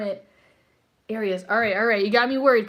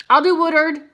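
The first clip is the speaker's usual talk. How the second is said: "I'll do Woodard" louder and higher, bouncing on "do"; perky repeat; 4 semitones higher, 5 dB louder than usual. Real, not acted. Reader picking the next viewer, announcing "I'll do Woodard" perkily.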